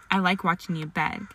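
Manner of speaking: usual speaking voice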